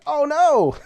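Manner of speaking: sudden high laughing cry; real dismay breaking into a laugh; surprised oh no. Real, not acted. Player sees Jersey losing, a high surprised cry. Dismay at bad game state.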